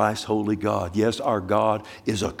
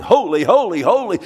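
loud, high "holy, holy, holy"; crying out; 8 semitones higher, 7 dB louder than usual. Retold or imitated. Preacher voicing angels crying out before the throne, loud. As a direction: cry it out like the angels.

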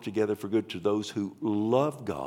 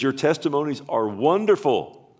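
higher and louder, ringing out the line "wonderful"; proclaimed verse; 6 semitones higher, 7 dB louder than usual. Real, not acted. Preacher booming the verse to the congregation, proclaiming. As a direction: declare it to the whole church.

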